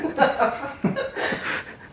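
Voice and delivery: unvoiced high giggle; breathy laugh. Real, not acted. Breaks up reading hate mail.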